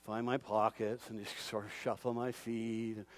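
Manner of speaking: low, soft, hopeless muttering; mock defeated mumble. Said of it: "walking around going".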